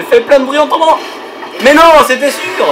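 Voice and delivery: exasperated shout. Real, not acted. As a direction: drag it out in loud despair. Falls and makes noise.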